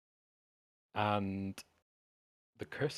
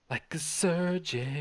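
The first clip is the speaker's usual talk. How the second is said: high, slow and drawn-out repeat; dramatic echo; 7 semitones higher, 4 dB louder, slower than usual. Acted. GM echoing his own line, slow and high. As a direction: savour the phrase like a reveal.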